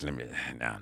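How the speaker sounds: very low, thick, quiet voice; Dave Tyree. Retold or imitated. Cigar-smoking comic starting his advice, deep thick voice. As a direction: rumble it low like the older comic. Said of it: "he got this, this deep, thick voice".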